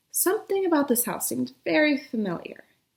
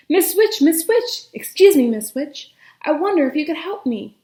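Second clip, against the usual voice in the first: eager calling; 5 semitones higher, 6 dB louder than usual. Acted. Prince calling out to witch.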